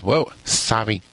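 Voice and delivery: light Vietnamese accent on the apology; understated Vietnamese accent, on request. Shown as a demonstration. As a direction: redo it with a hint of a Vietnamese accent. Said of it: "Do that again with a Vietnamese accent".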